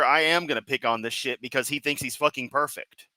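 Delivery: normal talking voice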